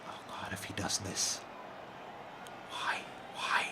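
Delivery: breathless hushed dread, almost voiceless; anxious whisper. Real, not acted. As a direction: whisper it as if afraid to look. Dreading the opponent's move.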